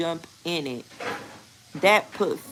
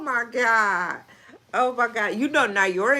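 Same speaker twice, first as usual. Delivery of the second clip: ordinary delivery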